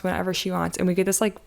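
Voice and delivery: ordinary talk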